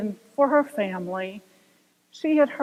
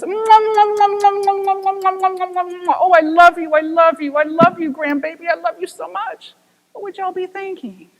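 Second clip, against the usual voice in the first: put-on higher, louder kissy baby talk; doting grandparent; 6 semitones higher, 6 dB louder than usual. Businessman doting over grandbaby photo, kissy voice. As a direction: smother it with kisses.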